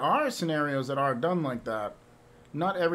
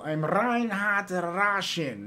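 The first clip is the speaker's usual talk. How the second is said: mock Reinhardt introduction; 4 semitones higher, much slower than usual. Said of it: "So he would tell people".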